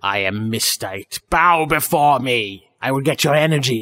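slow, low, menacing villain voice; Sailor Moon villain. Acted. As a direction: loom over them like a cartoon villain. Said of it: "Sounds like a villain from Sailor Moon".

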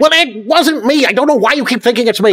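high, loud protest; indignant shout. Acted. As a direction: protest loudly. Frank protests accusation.

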